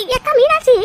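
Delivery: high, loud disbelief; can't believe what she hears; shocked. Acted. Old woman hearing of Marudhu's trouble, shocked and loud.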